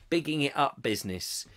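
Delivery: normal talking voice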